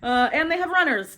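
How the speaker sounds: plain reading voice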